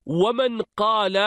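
usual speaking voice

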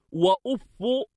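أف ending in a short "u", "uffu"; damma on fa. Shown as a demonstration. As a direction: say وأف as "wa-uf-fu". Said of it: "بضم الفاء".

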